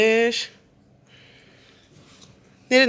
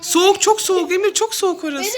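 playful excited; 9 semitones higher than usual. Acted. Hot-cold game, teasing child.

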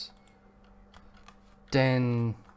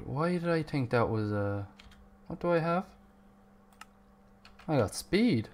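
normal talking voice

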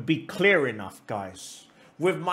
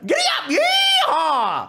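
hammed-up American rallying cry; cowboy whoop; 13 semitones higher, 5 dB louder than usual. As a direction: build into a high yeehaw. Cowboy outburst ends the rant.

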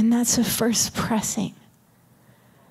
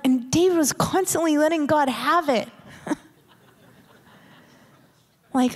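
usual speaking voice

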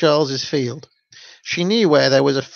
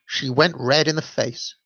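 ordinary delivery, nothing marked

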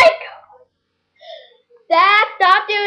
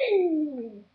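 laughing; 6 semitones lower, 14 dB quieter than usual. Real, not acted. Laughing at own joke.

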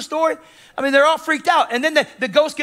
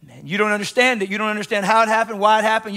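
ordinary talk, nothing marked

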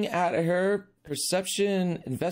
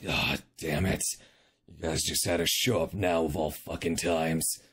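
gruff commander; 10 semitones lower, 4 dB quieter than usual. Acted. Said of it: "And he's like".

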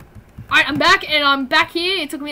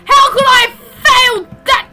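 frustrated shout; 9 semitones higher, 12 dB louder than usual. Real, not acted. Failing a jump in game.

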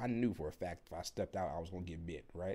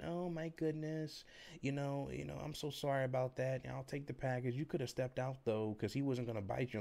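mock apologetic owner, higher voice easing down; goofy dog owner; 6 semitones higher than usual. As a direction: imitate an owner making silly excuses in a high voice. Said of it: "say the typical bullshit they always say".

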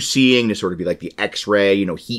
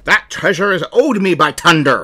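higher, louder pirate captain; a hint of a pirate boasting; 8 semitones higher, 4 dB louder than usual. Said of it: "I'm gonna do a pirate voice".

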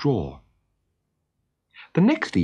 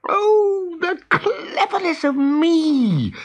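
very high, drawn-out gleeful crow; boastful boy's exultant cry; crowing with pleasure; 4 dB louder, much slower than usual. A narrator's reading. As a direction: crow it out slowly, hugely pleased with yourself. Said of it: "crowing with pleasure".

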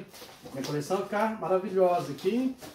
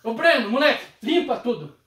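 loud bossy holler across the house; joking call-out; 2 semitones higher, 7 dB louder than usual. Retold or imitated. Joking that he calls Breno to clean up, loud holler. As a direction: call the kid loudly like a boss giving an order. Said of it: "Aí eu chamo assim".

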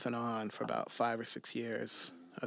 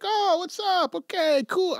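breezy friends; 10 dB louder than usual. Retold or imitated. Retelling the late friends' casual greeting.

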